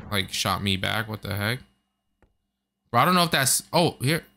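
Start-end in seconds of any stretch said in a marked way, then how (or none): none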